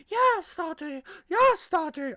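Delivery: put-on high, loud cry as a British child. Podcaster imitating girls in bed, British child's high cry. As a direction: squeal it high in a mock British accent. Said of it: "sound like a British child".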